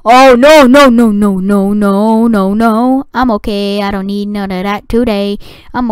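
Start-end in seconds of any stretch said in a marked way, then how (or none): 0.0-3.0 rising panic into fading no's; panicking at the disaster; panicked no no. Real, not acted.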